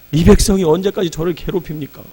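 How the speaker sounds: anguished cry. Retold or imitated. Moses crying out in prayer.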